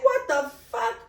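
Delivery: retold stunned outrage, drawn out; slow, incredulous reenacted outburst; exasperated disbelief. Woman retelling her shock at police losing her report, exasperated disbelief. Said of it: "like, that's how I felt".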